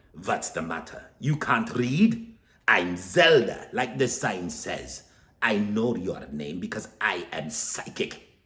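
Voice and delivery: put-on slow, loud, accented scolding; brusque psychic. Zelda mocking Stanley before reading his palm, brusque. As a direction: sneer at him like a stern fortune teller.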